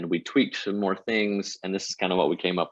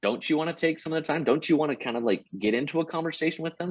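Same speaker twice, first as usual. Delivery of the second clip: higher-pitched, quick mock objection; imitated sceptical challenge; 8 semitones higher, faster than usual. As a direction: question it like a doubtful colleague, pitched up. Voices people challenging his idea.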